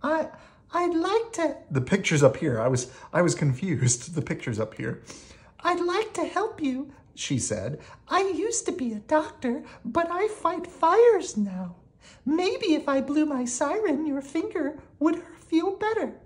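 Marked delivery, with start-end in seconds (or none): 0.0-1.6 very high, small character voice; high-voiced helpful lady; doctor turned firefighter. A narrator's reading. 5.6-6.9 very high, small character voice; high-voiced storybook lady; doctor turned firefighter. A narrator's reading. 8.1-16.1 very high, small character voice; high-voiced storybook lady; doctor turned firefighter. A narrator's reading.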